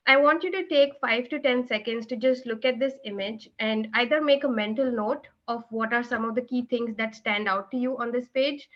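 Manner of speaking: normal talking voice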